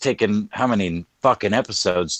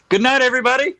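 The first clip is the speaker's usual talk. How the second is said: high sing-song goodnight; 4 dB louder, much faster than usual. Pretend. Mock sign-off, high.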